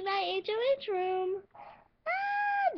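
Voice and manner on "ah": short high shriek of glee; excited shriek. Acted. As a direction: let out a thrilled little shriek.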